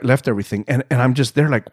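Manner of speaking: ordinary delivery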